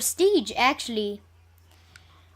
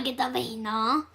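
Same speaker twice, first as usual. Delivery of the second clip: normal talking voice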